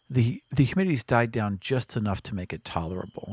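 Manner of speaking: ordinary delivery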